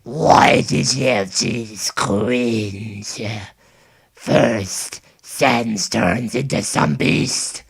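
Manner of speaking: slow, loud, strained creepy growl; Gaster voice. Shown as a demonstration. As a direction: growl slowly like a monster. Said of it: "show you how the, uh, the Gaster version".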